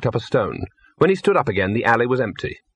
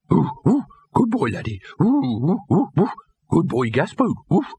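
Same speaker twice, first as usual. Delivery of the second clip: eager parroting dog; 7 semitones higher than usual. Acted. Dog Laddie parrots human words.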